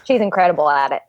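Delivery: ordinary delivery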